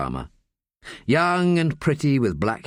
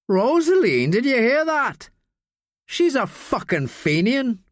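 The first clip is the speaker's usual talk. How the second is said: put-on sneering drunk student; 12 semitones higher, slower than usual. Drunk student pressing Daniel to fetch beers, high jeering voice. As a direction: lean in and goad him like a drunk bully. Green jeering after them.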